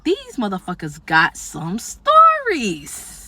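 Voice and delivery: very slow, savoring aside; slow knowing drawl. Retold or imitated. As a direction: let it sink in, amused. Closing inner comment, amused.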